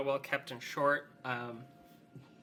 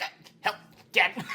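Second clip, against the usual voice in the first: squashed in a hug; 6 semitones higher, 6 dB louder than usual. Acted. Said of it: "She's like".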